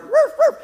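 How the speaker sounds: very high yapping woofs; imitated dog bark. Preacher pranking a driver on the phone, high fake bark. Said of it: "he heard the dog bark".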